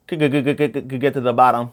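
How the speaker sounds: stuttering over the first word; tongue-tied, stuck on a syllable; slurring words. Real, not acted. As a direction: stumble repeatedly on "could" before getting it out. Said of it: "I'm still slurring my words".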